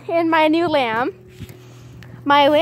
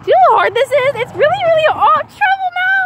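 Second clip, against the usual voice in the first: high, louder exasperated whine; exasperated high pleading at the lamb; 13 semitones higher, 4 dB louder than usual. Real, not acted. Walking the lamb who won't behave, exasperated high whine.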